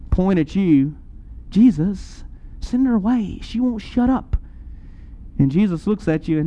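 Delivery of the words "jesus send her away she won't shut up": ordinary talk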